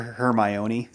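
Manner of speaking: "Hermione" mispronounced slowly to rhyme with baloney; childhood mispronunciation. Shown as a demonstration. Admitting a childhood mistake to the co-host, "Hermione" mispronounced like baloney. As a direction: say "Hermione" as her-my-OH-nee, like baloney. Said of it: "I used to mispronounce Hermione".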